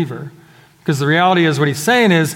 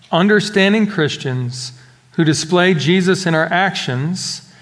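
normal talking voice

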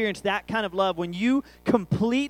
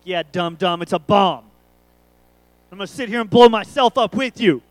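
loud sarcastic retort, building louder; mock exasperated sarcasm; 12 dB louder than usual. Dad answers son's bomb question, loud mock sarcasm.

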